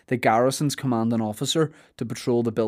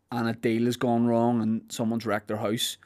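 normal talking voice

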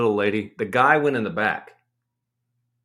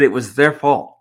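louder, real anger bursting out; angry outburst; 7 dB louder than usual. Real, not acted. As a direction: nearly shout "It was their fault". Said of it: "I'm getting so angry again".

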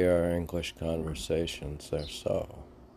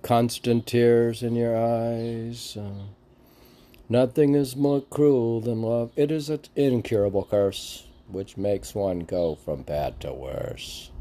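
dramatic lyric recitation; 5 semitones higher, 4 dB louder than usual. Acted. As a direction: declaim it like a tragic ballad. Recites lyrics amid chat.

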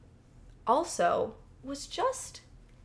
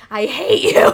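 loud comic shout; 3 semitones higher, 16 dB louder than usual. Pretend. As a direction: yell it as a joke. Joke suggestion, shouted.

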